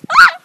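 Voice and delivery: high ticklish scream; tickled shriek. Real, not acted. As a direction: shriek while being tickled. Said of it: "Don't tickle me".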